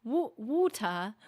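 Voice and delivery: British "water" with a clear T; slow British take on "water", T sounded; British accent. Shown as a demonstration. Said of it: "British people pronounce it with the T".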